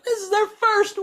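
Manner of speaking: high-pitched excited squeal; squealing girlfriend. Retold or imitated. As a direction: squeal it like an overjoyed fan. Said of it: "she was, like, having a fit".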